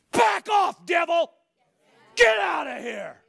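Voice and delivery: shouted command at the devil; loud mock confrontation; rebuke. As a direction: bark it straight at the enemy. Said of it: "rebuking the devil".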